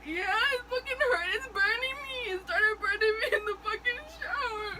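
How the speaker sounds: sobbing in pain, voice high; fake crying. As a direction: wail and sob as if hurt. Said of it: "Damn, I'm a good actor".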